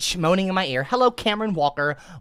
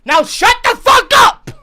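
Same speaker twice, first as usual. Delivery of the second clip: higher, louder shout; mock yell; 11 dB louder, slower than usual. Streamer yells at chat to shut up. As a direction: bark it at chat.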